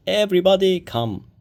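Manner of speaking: loud high call across the yard. A narrator's reading. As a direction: call everyone in from outside. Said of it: "Later, she called".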